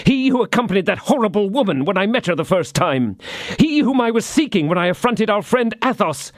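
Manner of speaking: high, tense rush of dread and fury; feverish agitation. A narrator's reading. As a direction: pour out the fear of his evil genius. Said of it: "pale with passion".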